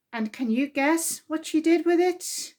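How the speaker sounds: ordinary delivery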